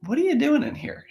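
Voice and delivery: incredulous divine question. Retold or imitated. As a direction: voice God gently teasing the prophet. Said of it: "but he's like".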